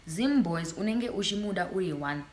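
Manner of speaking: usual speaking voice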